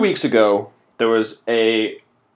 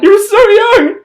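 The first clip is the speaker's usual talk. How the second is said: even higher hammed-up wail; mock mourner wail; 8 dB louder than usual. Mock mourner wailing louder.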